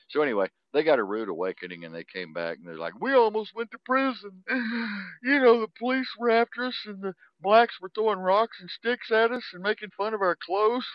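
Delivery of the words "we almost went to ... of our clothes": very high, loud imitation of complaining; hammed-up high-pitched outrage, on the edge of laughter; mock distraught. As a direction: retell it in a squealing mock panic. Retells friends' shocked report after trip.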